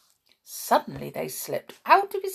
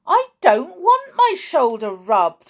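loud, higher-pitched cross protest; getting cross; 7 semitones higher, 9 dB louder than usual. A narrator's reading. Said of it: "getting cross".

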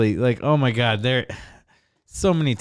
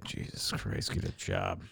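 whispered, head-shaking dad; disappointed whisper; 15 dB quieter, slower than usual. Retold or imitated. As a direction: breathe it out like a weary dad. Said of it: "he just shake his head like".

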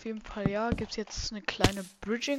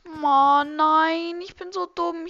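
frustrated outburst; 6 semitones higher, 11 dB louder, slower than usual. Real, not acted. Realizes own mistake.

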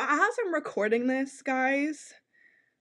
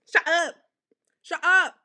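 playful shut up; 4 semitones higher, 6 dB louder than usual. Retold or imitated. Mock giggling gossip guy.

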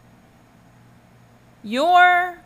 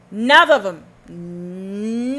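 1 drawn-out denial; 7 dB louder, much slower than usual. Real, not acted.

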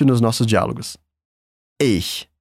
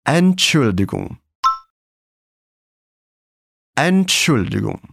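Entschuldigung spoken slowly, syllable by syllable; slow full word; 3 semitones lower, slower than usual. Shown as a demonstration. Teacher modelling the full word slowly. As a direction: build the whole word slowly. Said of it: "falar essa palavra completa".

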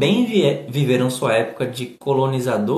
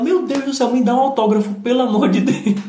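much higher, gushing starstruck plea; fan-like excitement; 9 semitones higher than usual. Retold or imitated. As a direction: beg for the autograph like a fan. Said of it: "porque ela". (colega pedindo autógrafo, empolgada e bem mais aguda)

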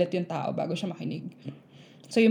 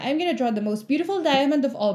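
put-on higher, sing-song little girl bragging; proud kid; 6 semitones higher than usual. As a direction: brag like a child showing off her drawing. Teacher voices boastful little girl.

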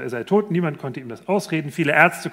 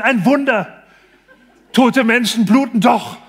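loud, high, laughing exclamation of wonder; delighted cry; 7 semitones higher, 8 dB louder than usual. Retold or imitated. Patient cries out to the doctor, thrilled and laughing. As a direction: shout it like a miracle just happened. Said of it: "Der Mann ruft ganz begeistert".

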